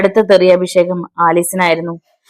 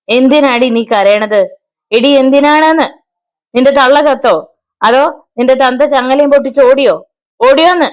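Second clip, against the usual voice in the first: put-on higher, louder drunk yelling; drunk fury; 6 semitones higher, 3 dB louder than usual. A narrator's reading. Drunk husband yelling at his crying wife, furious. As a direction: pound her with cruel questions.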